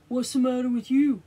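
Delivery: fast, loud walrus voice; quick gruff walrus asking. Acted.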